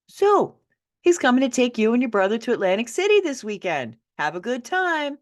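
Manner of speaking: high, loud, falsely cheerful; breezy mother. Acted. Mother cheerfully sending child off with abuser, breezy. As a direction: toss it off like it's nothing.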